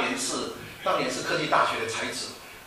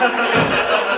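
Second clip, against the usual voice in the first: short amused laugh; 6 semitones higher, 7 dB louder than usual. Real, not acted. Laughs at giraffe comparison.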